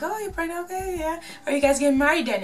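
high, forced surprised voice; mock surprise. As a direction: act politely startled by the news. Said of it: "she's like".

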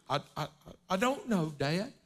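put-on halting, soft little girl; a guilty child stumbling over words; stammering daughter. Daughter stammers to her father, soft and halting. Daughter voiced in retold story.

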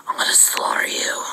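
unvoiced villain whisper; whispered toy threat; menacing whisper. Toy Arceus threatens everyone.

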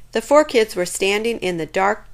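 plain reading voice

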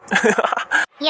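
breathy burst of laughter; cheerful, cheered-up laugh. Acted. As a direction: laugh out at a friend's joke. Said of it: "எப்பயுமே இப்படி சிரிச்சுக்கிட்டே இரு".

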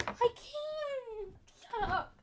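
high laughing protest; giggly exasperation; laughing despair. Real, not acted. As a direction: laugh through frustration. Laughing frustrated.

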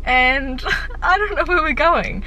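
giggling through the words; laughing. Real, not acted. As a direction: laugh while you say it. Giggling about surprise trip.

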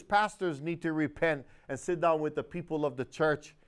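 normal talking voice

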